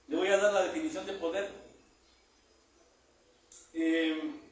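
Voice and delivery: preaching at full force. Acted. Preacher driving home the meaning of power, loud. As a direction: hammer each word home to the church.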